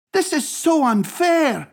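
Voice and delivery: put-on high-pitched boy's complaint; upset protest. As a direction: protest up high, like a wronged child. Boy protests mother's deafness.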